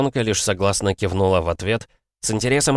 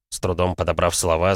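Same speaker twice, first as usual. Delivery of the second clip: ordinary delivery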